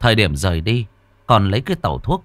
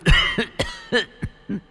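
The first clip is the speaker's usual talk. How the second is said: put-on harsh old man cough. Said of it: "cứ như thế mà ho".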